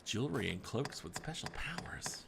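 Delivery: soft breathy whisper. Real, not acted. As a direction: whisper it like a secret. Hushed aside over shop menu.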